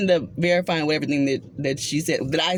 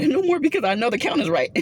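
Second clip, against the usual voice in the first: laughing; 8 semitones higher than usual. Real, not acted. Laughs while telling the story.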